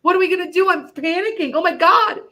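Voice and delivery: high, loud, panicked pleading; panicking daughter. Retold or imitated. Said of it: "she called me crying".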